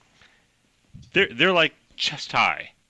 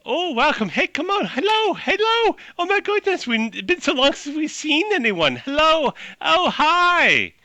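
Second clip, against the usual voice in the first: much higher, eager greeting voices; cheerful mushroom chorus; 4 dB louder than usual. Acted. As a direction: squeak out a lonely welcome like friendly mushrooms. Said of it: "they have faces. And they go".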